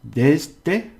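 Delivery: desde with the first syllable hit high and loud; stress on first syllable. Shown as a demonstration. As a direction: punch the start of desde. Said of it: "because we got a vowel".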